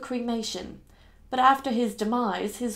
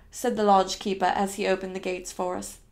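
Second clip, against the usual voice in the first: ordinary delivery, nothing marked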